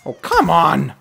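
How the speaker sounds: real frustrated outburst; exasperated. Real, not acted. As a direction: cry out in frustration at missing. Frustrated gamer missing shots.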